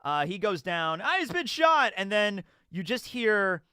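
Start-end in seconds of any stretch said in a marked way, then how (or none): 1.0-1.9 high pitched mock panic; hammed-up alarm; alarmed cry. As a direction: blurt it out like a startled bystander. Acting out the chapter's alarm.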